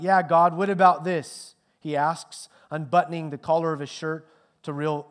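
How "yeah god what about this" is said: loud, high challenge to God; ringing out. A narrator's reading. Hanged man calling out to God, loud ringing challenge. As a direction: ring it out across the crowd. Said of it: "the voice of a man rings out".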